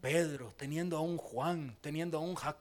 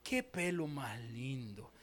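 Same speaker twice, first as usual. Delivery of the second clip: quoted admiration; 5 semitones lower, 7 dB quieter, slower than usual. Retold or imitated. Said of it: "uno dice".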